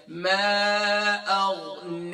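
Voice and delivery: "ما اغنی" recited very slowly with a thin ne at the end; long drawn "ما اغنی", nun kept light. Shown as a demonstration. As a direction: Quran teacher elongating "ما اغنی" with a light nun. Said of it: "ہم اب اسے light کریں گے".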